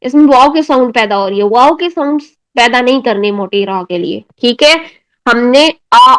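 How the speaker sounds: normal talking voice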